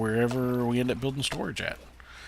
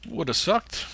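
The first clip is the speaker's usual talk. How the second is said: higher, louder relieved outburst; relieved exclamation; 10 semitones higher, 7 dB louder, slower than usual. Real, not acted. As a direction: blurt it out in relief. Nearly fell to death.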